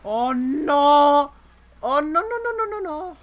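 loud, high panicked cries; rising real panic. Real, not acted. Tank in sudden trouble.

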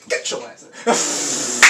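slow, drawn-out lightly voiced angry dad; Uncle Phil impression. Mild Uncle Phil throwing Jazz out of the house. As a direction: stretch it out like a fed-up father. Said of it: "Remember Uncle Phil".

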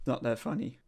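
ordinary delivery

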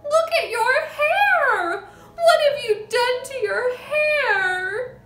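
slow, drawn-out high shriek. A narrator's reading. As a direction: wail it at full pitch in horror. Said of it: "the mother was shrieking".

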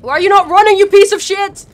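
loud, high frantic shout; real shouting, rising in panic; panicked yelling. Real, not acted. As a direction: yell at your character as the chase closes in. Yelling at character in chase.

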